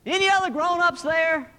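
high, loud phone voice; loud telemarketer. Acted. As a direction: quiz a child loudly down the line. Telemarketer calling a whispering child.

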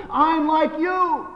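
high-pitched, drawn-out cry of realization; the little lion crying out, high and slow; excited discovery. Acted. Little lion recognising himself in the big lion, excited discovery. Young lion's delighted discovery in story.

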